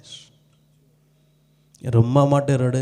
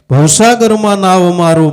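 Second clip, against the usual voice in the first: louder, higher preaching call; proclaiming; 6 semitones higher, 6 dB louder than usual. Acted. Preacher calling the verse out loud to the congregation. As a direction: cry the verse out to the hall.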